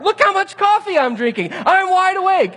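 high, loud, comically outraged yelling; angry insistence. Retold or imitated. Driver proving to his passengers he is awake, angry yelling. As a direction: yell it as overblown self-defence. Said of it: "I am so angry about it".

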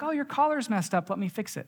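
a hint of the fussing wife fixing his collar; wife voiced. Wife fixing his collar at the door, lightly mimicked. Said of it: "She's like".

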